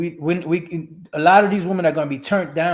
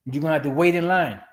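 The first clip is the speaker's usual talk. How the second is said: usual speaking voice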